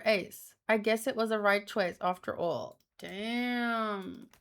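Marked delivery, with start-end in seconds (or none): none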